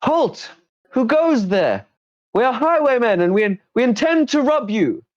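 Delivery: high-pitched bandit shouting to stop travellers; bandit yelling a halt. Acted. Highwayman stops the party on the trail, high loud yell. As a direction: yell out as a squeaky highwayman blocking the pass. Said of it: "And a voice yells out".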